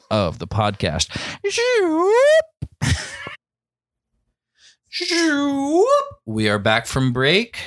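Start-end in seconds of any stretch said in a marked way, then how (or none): 1.5-3.0 high sliding zoop; a silly rising zoop noise; zoop sound effect. Shown as a demonstration. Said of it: "here's the little sound effect". 4.9-5.9 second high zoop; zoop sound effect. Shown as a demonstration. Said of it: "here's the little sound effect".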